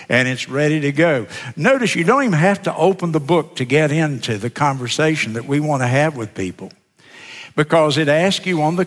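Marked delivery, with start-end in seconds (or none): none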